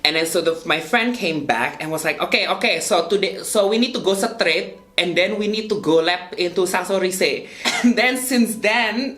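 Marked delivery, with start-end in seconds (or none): 2.2-7.5 imitating a friend's thick Indonesian-accented English. Friend passing on store directions, imitated Indonesian accent. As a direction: relay directions in a put-on Javanese-mixed Indonesian accent. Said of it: "We literally only spoke that way".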